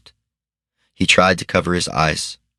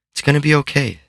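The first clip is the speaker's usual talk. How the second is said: Sid reassuring, higher; 9 semitones higher, faster than usual. A narrator's reading. Sid comforting Harold.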